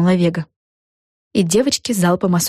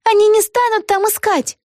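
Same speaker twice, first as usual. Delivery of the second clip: put-on high-pitched girlish exclamation; exclaiming. A narrator's reading. Vega proposing escape to her friend, high girlish exclaiming. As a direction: blurt out the plan in a high little-girl voice. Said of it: "воскликнула Вега".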